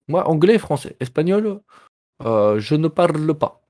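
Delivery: slow, clumsily faked Spanish accent; attempted Spanish accent. Streamer jokes about languages spoken, attempted Spanish accent. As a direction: try a Spanish accent and miss, drawn out. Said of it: "Je sais pas comment faire l'accent espagnol".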